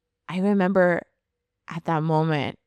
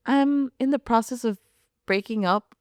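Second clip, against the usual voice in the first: nonchalant; 7 semitones higher than usual. Retold or imitated. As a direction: excuse yourself calmly on the phone, voice lifted. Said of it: "just saying it so nonchalantly".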